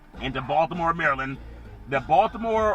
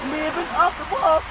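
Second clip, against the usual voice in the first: high imitation; 11 semitones higher than usual. Host tossing in a [name] line, high.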